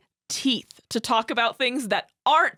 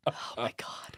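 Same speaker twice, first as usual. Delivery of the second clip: real hushed, whispered dismay; appalled whisper; 11 semitones lower, 11 dB quieter than usual. Real, not acted. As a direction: whisper it under your breath, aghast. Appalled aside after the quote.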